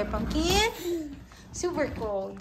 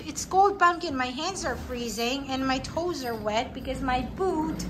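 normal talking voice

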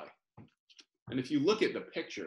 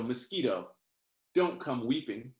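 ordinary delivery

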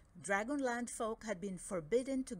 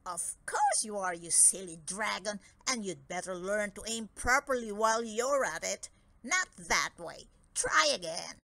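scolding gnome; 5 dB louder than usual. Acted. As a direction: boss the dragon around harshly. Franz scolds dragon.

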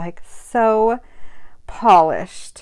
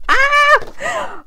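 short high squeal of glee; excited squeal; 4 dB louder than usual. Real, not acted. Woman thrilled at maybe getting a little dog, excited squeal. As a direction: let out a high, delighted "Ah!".

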